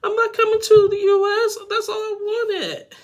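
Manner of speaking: very high, loud mock wail; heartbroken kid. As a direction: squeal it as a hammed-up crushed child. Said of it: "broke that kid's heart. He's like".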